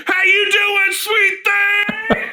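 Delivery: high, loud sleazy catcall; catcaller voice. Acted. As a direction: holler like a street catcaller.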